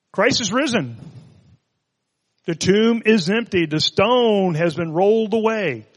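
high, ringing Easter proclamation; lifted voice, stretching out the last phrase; triumphant proclamation. Real, not acted.

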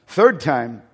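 ordinary talk, nothing marked

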